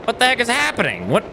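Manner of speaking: baffled outburst. Real, not acted. Streamer baffled as the boost fails, high startled outburst. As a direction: shoot up in pitch, honestly confused. Game suddenly stops working.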